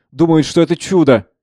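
mildly incredulous exclamation. Acted. Savva tells Speransky of the fools, mildly incredulous. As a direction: scoff at their belief. First take, before laughing.

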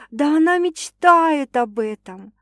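high, loud, slow exclamation; fervent. A narrator's reading. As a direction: declare the longing with full conviction. Heroine's fervent inner exclamation.

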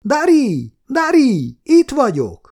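high, loud call from the car window. A narrator's reading. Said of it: "és kikiabált".

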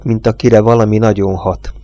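ordinary delivery

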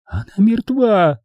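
high, drawn-out, strained woman's cry; high strained insistence; hoarse croak. A narrator's reading. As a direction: force it out slowly in a high voice. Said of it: "прохрипела Эдвина".

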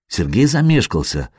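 ordinary delivery

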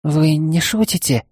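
put-on flustered young maid.

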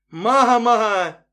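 loud high terrified scream. Acted. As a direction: yell for mom in terror. Nancy screams waking from nightmare. (испуганный крик)

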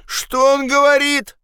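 very high, drawn-out call; shouted question. Acted. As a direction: demand it from afar in a high call. Guard shouts suspicious question. (подозрительный окрик)

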